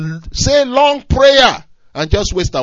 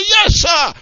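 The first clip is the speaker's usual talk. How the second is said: soldier's shout; 9 semitones higher, 4 dB louder than usual. Acted. Officer and soldier exchange.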